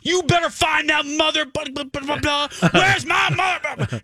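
high-pitched mock freak-out; losing her mind. Dog owner to brother on the phone, hysterical. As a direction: imitate a hysterical, screeching phone rant. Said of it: "she loses her mind on the phone".

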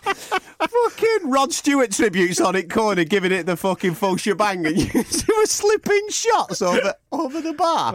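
high, laughing through the words. Real, not acted. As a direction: crack up as you picture it. Picturing the pub scene, laughing.

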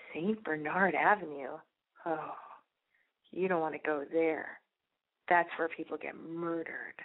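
low, slow, ominous carriage driver; slow and creepy. Retold or imitated. Carriage driver warning a tourist about the avenue, slow and creepy. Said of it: "he says it all slow and creepy".

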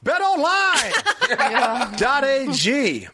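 slow, hammed-up ad announcer; hype ad read. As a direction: drag it out like a pitchman.

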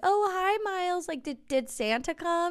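put-on high, sing-song voice for a toddler; baby talk. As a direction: coo at a little kid. Adults talking to toddler.

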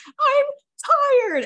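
high, loud tired whine; mock whine. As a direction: whine it like a toddler. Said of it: "I'm like".

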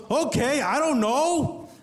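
put-on high, slow mom voice with a Vietnamese accent; mock Vietnamese mom. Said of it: "And she goes".

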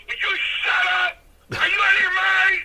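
high, loud exasperated yell in a retelling; re-enacted angry outburst; acted scolding. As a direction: act out an irate boss yelling, pitch jumping up. Acts out Strongbow's angry scolding.

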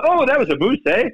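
imitating the Canadian playing partner's accent. Golfer imitates the Canadian partner's accent. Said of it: "Canadian way of saying".

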